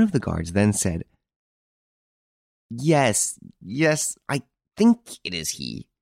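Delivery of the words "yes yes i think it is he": high start, dropping into uncertainty; halting agreement with doubt on "think"; hesitant guard. A narrator's reading. Guard unsure he recognises the prisoner, hesitant.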